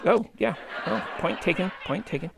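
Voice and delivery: low, soft muttered admission; sheepish concession. Acted. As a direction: back down under your breath. Concedes his own joke's point.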